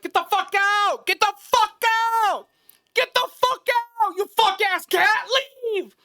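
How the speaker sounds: very high, very loud furious screaming; screaming at the cat. Retold or imitated. Driver kicking the seat while screaming at the cat, a very high furious scream. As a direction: lose it completely at the hidden cat.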